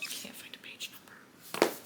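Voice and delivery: whispered to herself; a tired whisper while flipping pages. Real, not acted. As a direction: breathe it out, no voice. Whispering while searching page.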